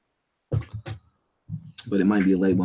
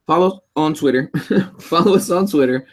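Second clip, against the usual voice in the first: laughing; 7 semitones higher, 5 dB louder than usual. Real, not acted.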